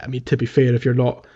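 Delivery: ordinary delivery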